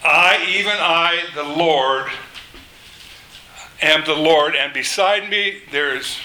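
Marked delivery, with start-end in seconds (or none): none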